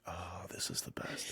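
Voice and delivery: hushed, breathy delight; imitating a child's awed whisper; whispered quote. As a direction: whisper it like a secret joy. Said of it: "she's like".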